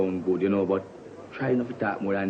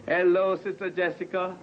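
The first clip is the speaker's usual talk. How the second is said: sing-song church greeting; 6 semitones higher than usual. Acted.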